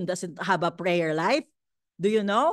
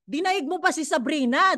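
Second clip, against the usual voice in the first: normal talking voice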